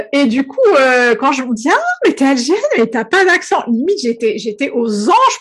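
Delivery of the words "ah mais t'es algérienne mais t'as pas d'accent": high mock voice of an impressed acquaintance; imitated compliment. Quoting French acquaintances praising her missing accent, high mock voice. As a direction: mimic the surprised, flattering remark in a higher voice.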